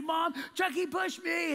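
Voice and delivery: put-on high-pitched, drawn-out kid's wail; tattling kid. Knocked-over kid tells mom on Chucky, loud tattling whine. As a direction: cry out to mom like a tattling child.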